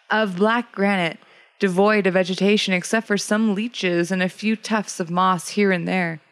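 plain reading voice

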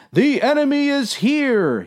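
high, drawn-up cry of alarm; yelled alarm. A narrator's reading. Sailor announcing the enemy's arrival, high yell. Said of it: "yelled the other man".

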